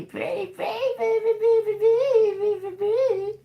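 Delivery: extremely high, squeaky parrot-like pretty; squeaky bird voice. Pretend.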